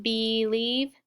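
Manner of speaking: "believe" as bee-leeve; both syllables of "believe" with long ee; long E pronunciation. Shown as a demonstration. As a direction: say "believe" with a clear ee in the first syllable. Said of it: "if you make a long E".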